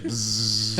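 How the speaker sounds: long imitated electric buzz; buzzing light. Imitating the cupboard light, a drawn-out buzz. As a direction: draw out a droning buzz.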